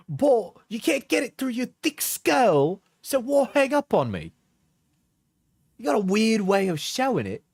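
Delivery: mocking high girly voice. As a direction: sneer it in a high mimic. Reads her messages in mock voice.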